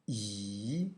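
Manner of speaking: 一 dipped low then rising like a question; 一 with a rising question lilt; English question tone. Shown as a demonstration. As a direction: say 一 as if asking in English, falling then lifting. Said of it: "in English question tone".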